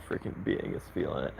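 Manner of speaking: fast whispered aside. Real, not acted. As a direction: mutter it under breath.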